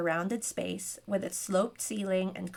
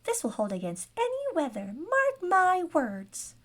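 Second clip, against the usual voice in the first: indignant gnome; 9 semitones higher than usual. A narrator's reading. Gnome vowing the carriage withstands any weather, squeaky indignation. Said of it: "spat the gnome".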